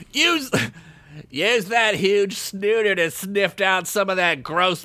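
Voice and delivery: high, slow commanding voice; bossy order. Acted. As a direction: boss him around. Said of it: "Chickpea ordered".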